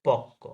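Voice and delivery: পক্ক drawn out as pok-ko, held before the second k; doubled ক. Shown as a demonstration. Teacher shows students the doubling, পক্ক as pok-ko. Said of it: "ক double হয়ে গেছে".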